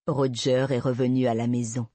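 usual speaking voice

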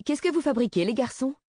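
high-pitched woman's interrupting question; acted, high-voiced challenge to two boys; another voice cutting in. A narrator's reading. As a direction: sing out as you catch the boys. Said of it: "une autre voix s'éleva".

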